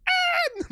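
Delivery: imitated high-pitched startled scream; very high, short re-enacted "Ah!"; nervous yelp. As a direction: let out a mock scared squeal. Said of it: "I had some nervous moments where I'm like".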